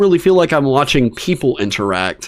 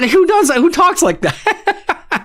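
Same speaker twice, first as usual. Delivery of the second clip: very high, disbelieving squeal into laughter; incredulous laughing; slower than usual. Real, not acted. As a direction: crack up in high-pitched disbelief. Incredulous rant breaking into laughter.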